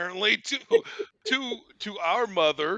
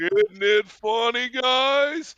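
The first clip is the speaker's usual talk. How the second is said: loud, slow, raised bellowing voice; Uncle Mike; 4 semitones higher, 6 dB louder, slower than usual. Retold or imitated. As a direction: bellow it slowly to the boys. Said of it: "has this big bellow of a laugh".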